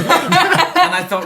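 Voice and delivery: slow, high laughing words. Real, not acted. Laughing while recalling.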